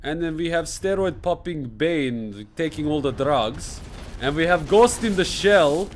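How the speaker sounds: put-on broken English in a thick Russian accent; comic Russian accent reacting to a trailer. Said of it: "reacting in Russian accent".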